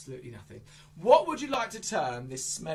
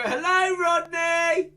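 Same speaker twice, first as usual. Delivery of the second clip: loud greeting; 9 semitones higher, 8 dB louder, much slower than usual. Shown as a demonstration. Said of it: "Everyone go".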